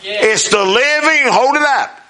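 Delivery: rousing sermon climax, words drawn out; emphatic preaching. Real, not acted. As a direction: proclaim it to the congregation, stretching key words.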